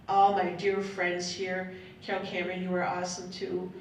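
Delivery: ordinary delivery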